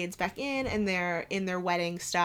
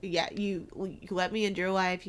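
ordinary delivery

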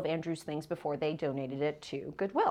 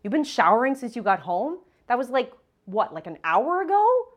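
higher, louder, incredulous whine; whiny ex impression; 5 semitones higher, 9 dB louder than usual. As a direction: whine it in disbelief, rising. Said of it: "I hear my ex's voice".

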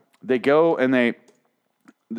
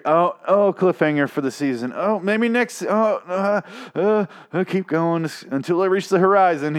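high, panting, hammed-up yearning; mock eagerness; 8 semitones higher than usual. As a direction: play a viewer straining ever onward. Mocks endlessly strung-along viewer.

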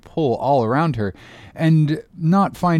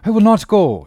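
much higher, louder desperate refusal; loud insistent plea; 9 semitones higher, 8 dB louder than usual. Acted. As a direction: refuse to leave, pleading hard. Father begging captain loudly.

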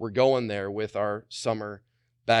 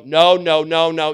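loud, raised-pitch scolding refusal; emphatic objection; 5 semitones higher, 12 dB louder than usual. Acted. Preacher showing neighbours how to object, loud and emphatic. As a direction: refuse loudly, hitting every word.

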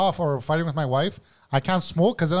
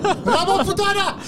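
high, louder laughing cheer; laughing exclamation; 14 semitones higher, 4 dB louder, slower than usual. Real, not acted. Reacting to thunderclap, a laughing cheer. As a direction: crow it while cracking up.

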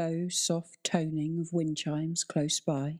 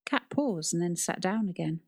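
plain reading voice

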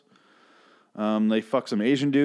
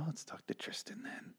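breath-only whispered imitation; mock whisper; 8 semitones higher, 19 dB quieter than usual. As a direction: whisper it as the character's reply. Voicing [name]'s sly reply.

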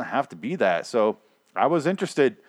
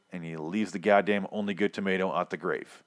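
usual speaking voice

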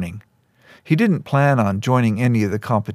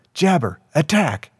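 higher-pitched command to attack; shouted command; 6 semitones higher than usual. A narrator's reading. Boy sets his tiger hawk on Keo, mild shouted command. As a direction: order the beast to attack, voice raised in pitch. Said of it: "shouted again".

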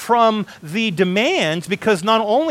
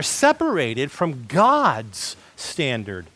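slow, with God's drawn out; stress on God's; 5 semitones lower, much slower than usual. Real, not acted. Preacher restating whose standard matters, slow stress on God's. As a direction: stretch out and stress the word God's. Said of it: "I put emphasis on the word God's".

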